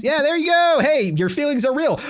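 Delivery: high, loud cheering; loud high praise; excited cheer. Real, not acted. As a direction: whoop her on, grinning. Cheering, laughing at line.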